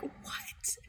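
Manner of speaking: barely voiced, stunned whisper; hushed mock disbelief; shocked whisper. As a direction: whisper it like a horrified player. Acts shocked players seeing gory game.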